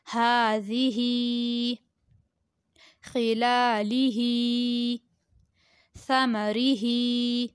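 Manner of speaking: slow recitation with long-held vowels; Qaida word recitation. Shown as a demonstration. Teacher reading Qaida words to pupils, slow recitation. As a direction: draw each word out evenly.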